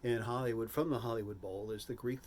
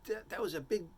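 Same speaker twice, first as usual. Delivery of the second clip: high-pitched, mock urging advisor voice; imitated advisors; 10 semitones higher than usual. Advisors urging [name] to tell his past, high mock voice. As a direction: put on a much higher voice to push advice. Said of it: "my advisors were all like".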